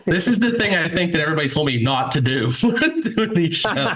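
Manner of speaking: real laughter breaking into the words; laughing. Real, not acted. Comedian admitting a mistake to the audience, laughing. Comedian cracks up at his crowd work.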